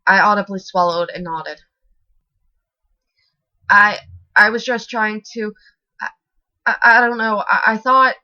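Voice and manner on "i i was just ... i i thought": high, loud frightened stammer. A narrator's reading. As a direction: stumble over the words in fear. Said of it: "I stammered".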